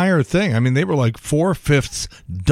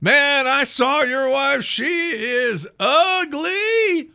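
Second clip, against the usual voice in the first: high, drawn-out backup singer shout-out; background singer's interjection; 12 semitones higher, much slower than usual. Retold or imitated. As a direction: call it high, stretching out UGLY. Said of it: "singers sort of off in the background goes".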